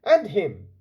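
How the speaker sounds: put-on young man's voice. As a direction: call out, surprised to recognise him. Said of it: "he said".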